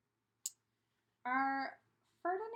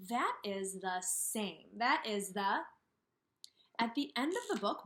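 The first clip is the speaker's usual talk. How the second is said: ordinary talk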